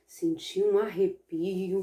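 usual speaking voice

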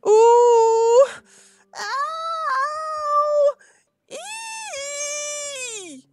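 very high, long-held pain noises; cried-out yelps. Acted. Mister McGee cannot find the flea, drawn-out acted yelps. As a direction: separate theatrical yelps, each drawn out. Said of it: "cried Mister McGee".

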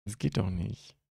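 normal talking voice

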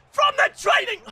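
high, loud squeaky announcer shout; squeaky shouted retry. Pretend. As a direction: yell it high like a cartoon.

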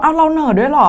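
high, loud mock surprise; surprised friend. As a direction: act shocked at being told you have an accent. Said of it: "เขาบอก".

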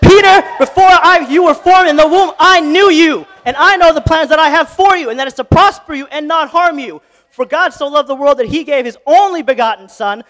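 high, loud preacher's cry; full-force preaching. Acted. Pastor preaching God's love to Peter, loud and soaring. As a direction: shout the promises over the congregation.

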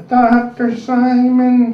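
put-on very slow, high drawn-out old woman's call; old lady caller. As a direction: stretch it out like a confused old lady on the phone. Old lady with memory loss calling.

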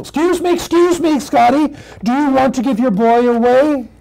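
high, hurried puppy voice; eager little puppy. Acted. Puppy interrupts Scotty and his boy, eager puppy voice. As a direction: butt in urgently as a small puppy.

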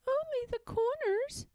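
put-on high little girl-pig voice. Pigwig admits the peppermints to Pigling, high and coy. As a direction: chirp it small and coy.

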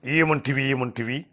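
slow, declamatory baritone film-hero voice; grand baritone impression, drawn-out words; NT Rama Rao. Shown as a demonstration. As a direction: build to a loud, grave mythological oration. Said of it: "NT Ramarao లాగా".

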